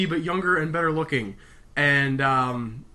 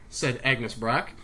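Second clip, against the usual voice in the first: plain reading voice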